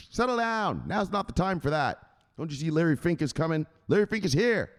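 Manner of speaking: high, worked-up mock fury; scolding boss. Boss disciplining underling who touched the Bitcoin, angry scolding. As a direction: scold between blows, pitched high.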